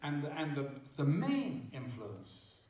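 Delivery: ordinary talk, nothing marked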